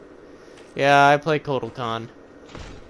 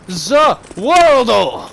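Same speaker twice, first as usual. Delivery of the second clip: slow, high, loud villain cry; drawn-out hammed-up anime shout; Dio stand shout; 7 semitones higher, 8 dB louder, much slower than usual. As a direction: bellow it like an anime villain summoning his power.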